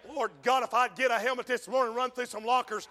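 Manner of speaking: ordinary talk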